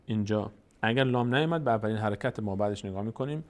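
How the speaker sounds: usual speaking voice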